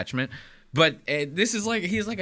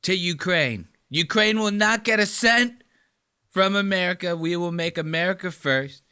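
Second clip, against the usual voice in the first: Donald Trump impression; 3 semitones higher than usual. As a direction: bellow like a bragging president. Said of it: "he's like".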